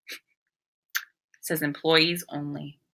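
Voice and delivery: ordinary delivery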